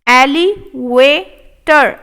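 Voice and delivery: elevator stretched slow; slow model take. Shown as a demonstration. Teacher modelling elevator slowly. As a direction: say it slowly, clearly. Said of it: "slow model take".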